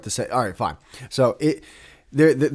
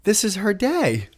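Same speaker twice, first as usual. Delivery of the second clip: heartfelt; 8 semitones higher than usual. Retold or imitated. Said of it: "where I'm like".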